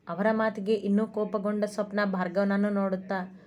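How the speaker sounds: ordinary delivery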